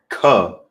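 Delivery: ka said loud and sharp; stressed ka for the class; emphasized ka. Shown as a demonstration. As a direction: give the ka a firm hit. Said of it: "like we did earlier on the chart".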